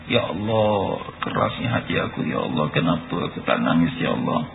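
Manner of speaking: low, soft, half-whispered lament to God; mournful plea. Acted. A worshipper who cannot cry, softly lamenting to God. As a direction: plead quietly over a heart that cannot weep. Sinner laments hard heart.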